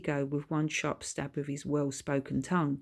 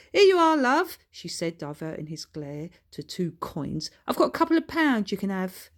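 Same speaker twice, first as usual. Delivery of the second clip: much higher, louder hurried mum; hasty wife; 4 semitones higher, 5 dB louder than usual. Acted. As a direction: rush to soothe him with coins. Wife hastily placating husband.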